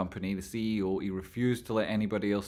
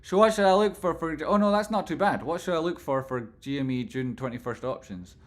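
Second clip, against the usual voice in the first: mock SEC agent; 7 semitones higher, 5 dB louder than usual. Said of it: "catch me, like, with questions like that".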